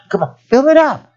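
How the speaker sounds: high-pitched goading; taunting dare. Retold or imitated. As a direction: goad them upward. Voicing the taunting challenge.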